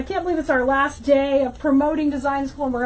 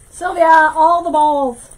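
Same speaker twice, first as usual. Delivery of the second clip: loud excited reply; 10 dB louder, slower than usual. Real, not acted.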